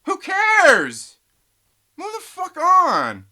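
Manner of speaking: loud, high shouted dismissal, slowing at the end; angry shout. Real, not acted. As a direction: yell it at the fans to move on. Ranting at quitting fans.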